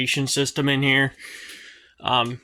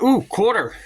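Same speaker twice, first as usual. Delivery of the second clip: pleasantly surprised; 7 semitones higher than usual. Real, not acted. Finds a coin while vacuuming.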